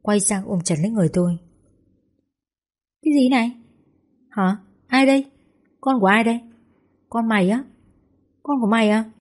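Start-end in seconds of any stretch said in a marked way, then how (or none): 3.0-9.1 higher-pitched burst of disbelieving questions; understated shriek of astonishment; mild startled yell. A narrator's reading. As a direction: exclaim in shock, short questions. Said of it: "mới hét lên làm con giật mình".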